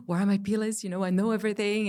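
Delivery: high, loud, rushed panicking voice; a sudden gasp then frantic questions; mock panic. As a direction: blurt it out in a flustered rush. Panicked inner voice after gasp.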